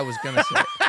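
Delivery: words squeezed out through a high laugh; laughing while talking. Real, not acted. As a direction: confess it while cracking up.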